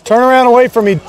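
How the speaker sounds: loud, high-pitched command; real, forceful shout; shouted police order. Real, not acted. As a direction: yell it at a suspect across the yard. Officer orders suspect to turn.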